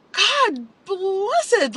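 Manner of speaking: high, loud, breaking cry on the words; real cry bursting out high and loud; crying outburst. Real, not acted. Crying out to viewers about still loving him.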